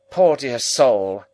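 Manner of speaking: abrupt high, loud, slow lament; abrupt and loud, mock-pitying; abrupt Camilla. A narrator's reading. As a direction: bark out the pity abruptly like a stern older sister. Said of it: "with an abruptness of manner quite my sister's".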